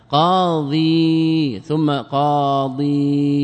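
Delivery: قاضي then قاضين stretched very slowly, half sung; drawn-out demo. Shown as a demonstration. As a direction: sing out each syllable of قاضين for the class. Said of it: "دون ال طبعًا".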